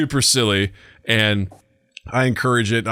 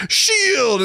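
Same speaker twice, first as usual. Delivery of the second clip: put-on very high childish call; high squeaky pretend shield; kid's shield call. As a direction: block it like an excited little girl. Daughter voiced in game.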